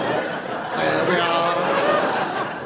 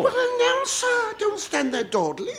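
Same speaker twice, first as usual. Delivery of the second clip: mock impatient squeal. Comic scolding the announcer to get on, mock impatience. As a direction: hurry him along with a shrill comic whine.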